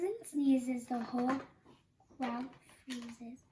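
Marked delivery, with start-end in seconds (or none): none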